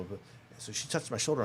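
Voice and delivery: ordinary delivery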